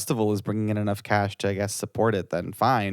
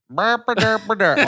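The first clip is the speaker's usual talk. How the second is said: high, louder goofy babble; silly derp voice; 9 semitones higher, 6 dB louder than usual. Retold or imitated. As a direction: mimic a mindless poster, high and dopey. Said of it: "one of those people that's just like".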